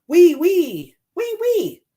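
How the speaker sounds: high, drawn-out little insect cry; high storybook mosquito voice; mosquito's whine. Acted. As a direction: pipe it like a tiny weeping mosquito.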